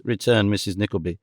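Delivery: ordinary delivery, nothing marked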